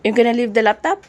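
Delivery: loud, pressing question. Real, not acted. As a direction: press him, loud across the room. Quizzing husband leaving for work. (malakas na tanong)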